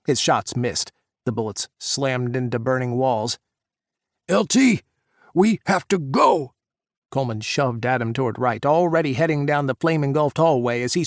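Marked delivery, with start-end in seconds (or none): 4.3-6.5 high, loud urgent plea; urgent shout. A narrator's reading. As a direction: shout to pull him out. Fire spreading, urging retreat.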